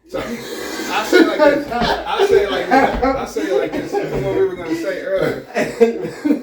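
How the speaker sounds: high, loud, cracking up; helpless real laughing fit while talking; talking through laughter. Real, not acted. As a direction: squeeze the words out between big laughs. Cracking up before a delicate answer.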